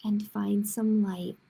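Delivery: put-on reading to children, clear and lively; picture book read aloud; story narration. A narrator's reading. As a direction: tell it like storytime for kids.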